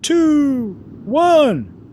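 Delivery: high, loud countdown; yelling countdown. Acted. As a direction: count down for the whole stadium. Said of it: "yelled Paige".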